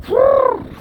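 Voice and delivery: high excited yell; loud whoop. Real, not acted. Cheering while riding downhill.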